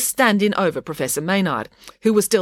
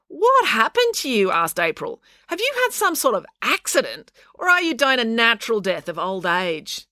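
put-on higher, questioning child of the story; lightly voiced April; 7 semitones higher than usual. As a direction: pitch the question up, a touch pointed.

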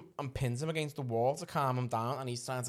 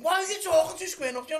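mother's angry voice; 6 dB louder, faster than usual. Retold or imitated.

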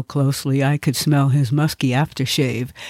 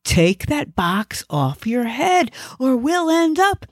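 panicked order; 9 semitones higher than usual. Acted. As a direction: plead urgently, pitch climbing. Panicked passenger pleads with driver.

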